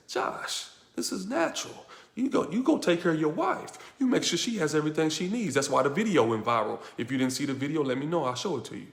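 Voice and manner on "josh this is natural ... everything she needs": higher-pitched, earnest self-coaching; voice of intelligence. Acted. Intelligence tells Josh to look after his wife, urgent higher-pitched coaching. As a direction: urge yourself in a lifted voice. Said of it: "intelligence says".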